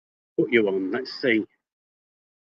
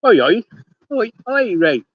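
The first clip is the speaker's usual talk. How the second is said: calling out; 8 semitones higher, 3 dB louder than usual. Real, not acted. Calling out a greeting to Rach.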